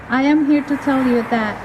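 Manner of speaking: ordinary delivery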